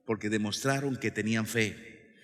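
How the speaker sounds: ordinary talk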